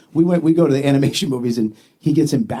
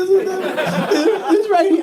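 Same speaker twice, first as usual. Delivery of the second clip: high, drawn-out blubbering; hammed-up tearful squeal; mock crying; much slower than usual. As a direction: squeak it out as if sobbing. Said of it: "I was fucking in tears".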